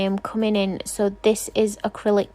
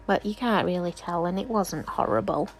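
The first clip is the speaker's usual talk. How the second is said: normal talking voice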